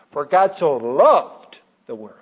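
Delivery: LOVED swelled loud and stretched out; slow down and lean hard on loved; stress on LOVED. Shown as a demonstration. Teacher showing how to meditate on a verse, stressing LOVED. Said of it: "I like to emphasize different words".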